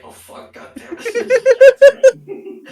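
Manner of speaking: high, loud curse breaking into laughter; Bedlam cursing. Acted. Player voices the character's dismay, cursing through laughter. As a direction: blurt it out, cracking up. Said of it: "Bedlam's like".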